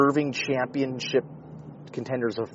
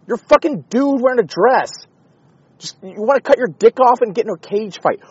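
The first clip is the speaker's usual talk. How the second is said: angry rant; 9 semitones higher, 5 dB louder than usual. Retold or imitated. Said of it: "It's like".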